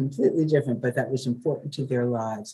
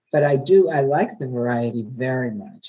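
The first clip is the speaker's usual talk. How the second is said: ordinary talk, nothing marked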